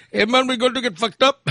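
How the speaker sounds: high, partying Indian accent imitation; weird Indian accent. Indian passenger out partying, high imitated Indian accent. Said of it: "It was the weirdest accent ever".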